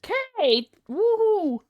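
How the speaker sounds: high, loud cheer for a viewer. Real, not acted. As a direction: whoop it out.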